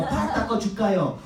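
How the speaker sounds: high, loud eager-to-please Korean politeness; fawning deferential younger kid; overly polite younger brother. Retold or imitated. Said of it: "You know".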